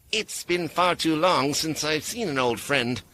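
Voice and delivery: strained, teeth-clenched politeness; through clenched teeth. A narrator's reading. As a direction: force it out through gritted teeth. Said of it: "she said through clenched teeth".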